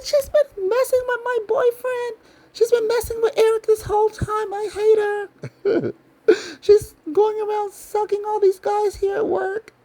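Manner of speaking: very high, mock-hysterical wail; imitated screamed complaint. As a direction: shriek your accusations in a squealing falsetto. Screaming her complaint back at managers.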